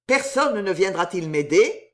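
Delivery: usual speaking voice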